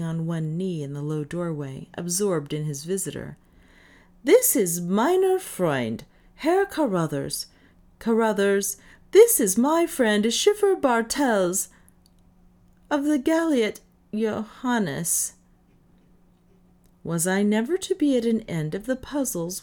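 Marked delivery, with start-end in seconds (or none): 4.2-15.3 hearty introduction. A narrator's reading. Davis hearty introduction in German.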